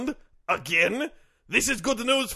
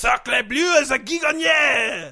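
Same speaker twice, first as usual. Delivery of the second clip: put-on French accent; slower than usual. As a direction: ham up a French-Canadian accent. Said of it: "Guy Gagné, what do you got for us?".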